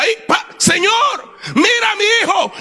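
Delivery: high, pleading shout to God; re-enacted fervent prayer; crying out in prayer. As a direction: plead for your child at the top of your range. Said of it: "hablaba con Dios y le decía".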